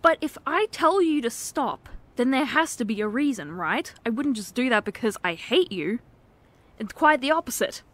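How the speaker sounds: ordinary delivery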